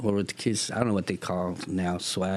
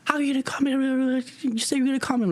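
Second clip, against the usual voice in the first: put-on eager kid yapping at the lady who never called; yappy kid; faster than usual. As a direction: yap at her like a chihuahua. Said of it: "Like a little chihuahua nipping at her heel".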